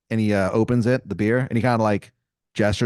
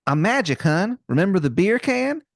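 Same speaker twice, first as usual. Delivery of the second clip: higher-pitched, hammed-up Maryland accent; Wizard of Baltimore, bad Maryland accent; 8 semitones higher than usual. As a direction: put on a terrible Maryland twang. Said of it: "this man's accent is something".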